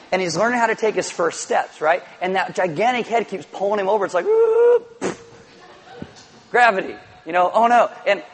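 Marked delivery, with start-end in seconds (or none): 4.3-5.2 high squeal then a pfft; toppling noise. Retold or imitated. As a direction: mimic a toddler tipping over. Toddler toppling over, imitated.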